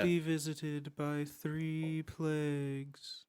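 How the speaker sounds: slow, eerie villain warning; slow ominous voice. Retold or imitated. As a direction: intone it like a curse. Quoting creepy cartoon villain.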